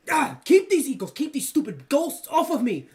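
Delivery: high, loud panicked yell; panicked shout. Acted. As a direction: shriek it out in alarm. GM voices ghost's cry.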